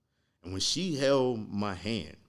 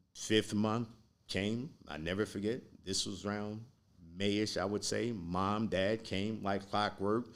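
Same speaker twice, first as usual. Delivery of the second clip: normal talking voice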